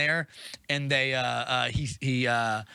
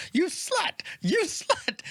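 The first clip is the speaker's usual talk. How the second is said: mock furious; much slower than usual. Wizard whipping his dragon, hammed-up fury. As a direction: act out berating between blows.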